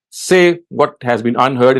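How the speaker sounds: ordinary talk, nothing marked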